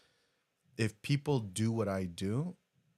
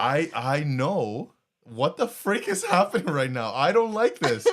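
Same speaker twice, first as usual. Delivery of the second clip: loud protest climbing into high laughter; emotional, flustered laughing; 5 semitones higher, 8 dB louder than usual. Real, not acted. Fighting emotion in front of co-host, flustered laughing. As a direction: thrown by your own emotion, laugh it off. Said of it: "I'm starting to get emotional right now".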